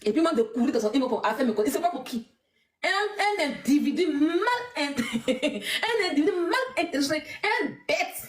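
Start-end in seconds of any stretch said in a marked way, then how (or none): 2.8-8.2 mocking laugh through the words; scornful giggle. Real, not acted. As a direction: laugh it out while insulting him. Mocking the man who wrote her.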